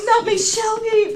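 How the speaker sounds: high, slow hammed-up lament; mock despair. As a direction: wail it like a melodrama heroine. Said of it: "I was like".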